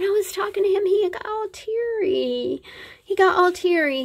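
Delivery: high, squeaky, choked-up retelling; tearing up. Real, not acted. Mother recalling her son getting teary, choked up. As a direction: voice climbs high as the tears threaten. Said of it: "I tear up".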